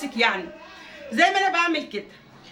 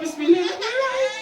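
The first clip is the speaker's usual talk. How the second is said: quick, high, alarmed cry of the phrase; real startled outburst, fast and high; startled exclamation; 8 semitones higher, faster than usual. Real, not acted. Child starts crying, alarmed.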